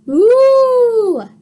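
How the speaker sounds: long, loud, high mock moo; cow moo. Cow climbs on, long loud mooing. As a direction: stretch out a big cow moo. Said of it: "said Cow".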